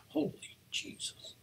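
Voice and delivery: breathless high whisper of alarm; hushed holy jesus; whispered awe. Retold or imitated. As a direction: gasp it under your breath, spooked. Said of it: "you're like".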